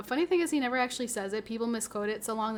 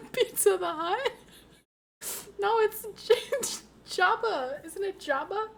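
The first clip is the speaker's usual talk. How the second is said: giggly; 8 semitones higher, 5 dB louder than usual. Real, not acted. Giggly confusion.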